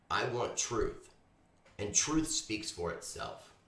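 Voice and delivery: slow, weighty declaration; slow and emphatic. Real, not acted. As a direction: let each word land slowly.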